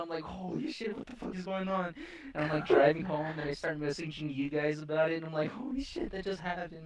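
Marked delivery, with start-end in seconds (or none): 0.2-1.9 high, hushed panic, retold; mildly acted freak-out in a raised pitch. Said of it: "freaking out". 5.5-6.8 high, softer retold panic; acted disbelief, pitched up and held down in volume; mild mock freak-out. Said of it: "I'm, like, freaking out".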